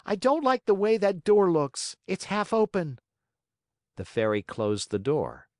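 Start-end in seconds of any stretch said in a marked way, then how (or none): 0.1-3.0 high, louder fussy whine; whiny child. Acted. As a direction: complain loudly like a spoiled boy. Puppet making excuses.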